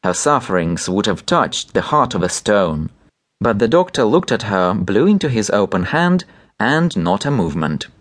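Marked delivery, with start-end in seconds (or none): none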